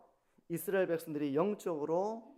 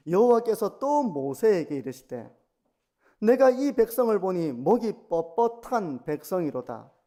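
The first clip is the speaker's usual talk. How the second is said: loud, declaimed Bible verse, slowing toward the end; solemn scripture reading; 2 semitones higher, 10 dB louder, slower than usual. Acted. Reads the scripture verses aloud.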